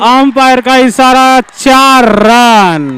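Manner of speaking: slow, drawn-out call of four runs; stretched boundary announcement. Real, not acted. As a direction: stretch out each word of the call.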